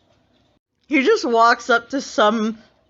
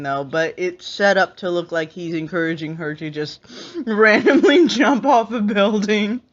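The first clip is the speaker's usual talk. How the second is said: usual speaking voice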